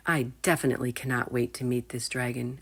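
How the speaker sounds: plain reading voice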